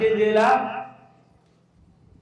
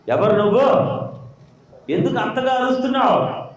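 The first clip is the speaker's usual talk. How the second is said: the angel asks again, higher and more insistent; angel's sharper repeat; 7 semitones higher than usual. Retold or imitated. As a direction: press the question a second time, raised. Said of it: "దేవుడు దూత దిగొచ్చింది". (దేవదూత గట్టిగా మళ్ళీ అడగడం)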